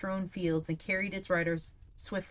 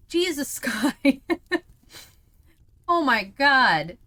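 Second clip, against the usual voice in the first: slow loud laughing exclaim; laughing disbelief; 8 semitones higher, 12 dB louder, slower than usual. Real, not acted. Reacting to chat.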